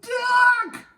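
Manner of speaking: sudden high, loud yell. A narrator's reading. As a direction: bellow a warning. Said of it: "So McD yelled".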